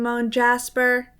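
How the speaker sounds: usual speaking voice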